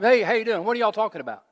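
a hint of a breezy drop-in greeting; casual stranger. Pastor playing himself walking up to his congregation, casual greeting. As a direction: stroll up and chat them up lightly. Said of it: "if you guys are out there talking".

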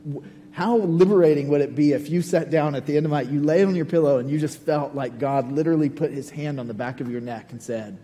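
ordinary delivery

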